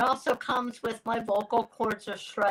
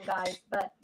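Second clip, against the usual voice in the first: ordinary delivery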